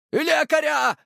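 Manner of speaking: very high, desperate shout; ear-splitting yell. A narrator's reading. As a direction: yell for help down the corridor. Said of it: "Заорал он так, что в ушах зазвенело". (истошный крик, очень высоко)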